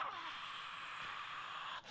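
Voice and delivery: goal roar. Retold or imitated. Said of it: "we all like".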